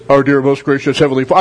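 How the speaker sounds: low, solemn baritone preacher voice; prayer voice. Retold or imitated. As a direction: intone it like a grand old preacher. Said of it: "his prayer voice".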